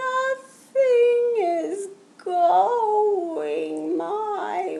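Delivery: high, slow, whiny near-crying voice; frustrated turtle. Acted. Turtle moans to Pete, high, slow and tearful. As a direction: drag it out like you could cry. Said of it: "Turtle did not look happy".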